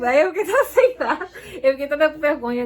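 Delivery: embarrassed laugh. Real, not acted. Wife telling viewers what her husband said, laughing embarrassed. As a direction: giggle it out, flustered and bashful. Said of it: "tímida".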